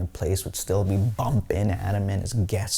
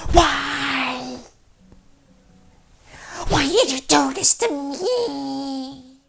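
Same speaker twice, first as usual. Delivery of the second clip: high, drawn-out wail from a night terror; yelling out; much slower than usual. Retold or imitated. Roommate having night terrors, high wailing yell. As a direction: imitate a tormented sleeper crying out in the night. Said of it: "he would just yell out".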